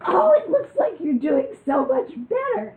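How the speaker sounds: high, loud put-on doctor voice; mock doctor gushing. She imitates her psychiatrist praising her progress, mock gushing.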